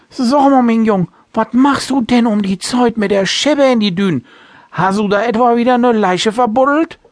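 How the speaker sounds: put-on loud, high tipsy old sailor; drunken slurring. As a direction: call out like a merry drunk captain. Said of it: "wollte der Betrunkene wissen".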